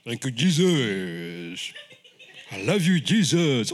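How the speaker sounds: slow, drawn-out, unexcited praise; subdued churchgoer. Acted. Same football fan now in church, mock-subdued. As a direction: draw out the praise with mock restraint. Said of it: "comes in the church and says".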